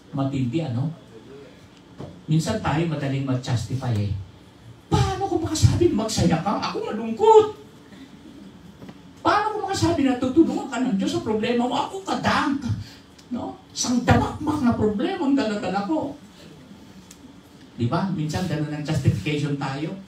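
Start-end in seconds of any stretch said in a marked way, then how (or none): 4.9-16.2 whiny complainer. Retold or imitated. Mimics believers justifying themselves.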